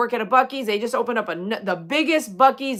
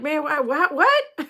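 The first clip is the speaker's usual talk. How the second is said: surprised; 6 semitones higher, slower than usual. Retold or imitated. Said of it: "I was like".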